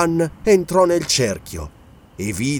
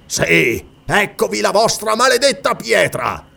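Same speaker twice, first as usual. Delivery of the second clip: higher-pitched furious cry; angry roar; 6 semitones higher than usual. A narrator's reading. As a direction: roar it out in rage. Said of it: "ruggì". (ruggito rabbioso)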